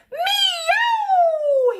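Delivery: very high loud meow; a startled cat flying through the air; cat yowl. A narrator's reading. Reggie yowling as he's blown across the room.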